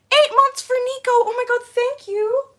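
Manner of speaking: very high, loud squealed thanks; excited thanks. Real, not acted. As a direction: squeal your thanks with delight. Thanking member at eight months.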